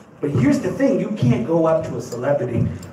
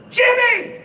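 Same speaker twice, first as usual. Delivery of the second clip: excited shout. Retold or imitated. John calls the dad, shouting his name happily. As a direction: yell the name like an old friend. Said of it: "John Pignette goes".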